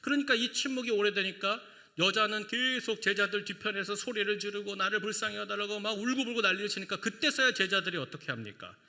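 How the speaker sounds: ordinary talk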